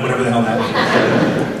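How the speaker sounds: ordinary delivery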